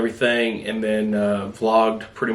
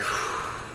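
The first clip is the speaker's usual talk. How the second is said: long breathy sigh of dread; acted sigh; 11 dB quieter than usual. Passenger seeing the cop wave them over, acted sigh. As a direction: let out a long, wordless exhale. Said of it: "I'm sitting there like".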